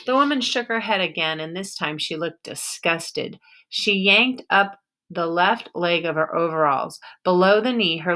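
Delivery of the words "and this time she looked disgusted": usual speaking voice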